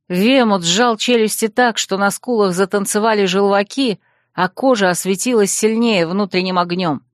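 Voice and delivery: plain reading voice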